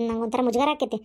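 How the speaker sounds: ordinary delivery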